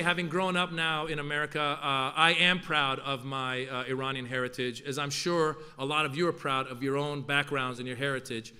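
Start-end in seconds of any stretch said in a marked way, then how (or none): none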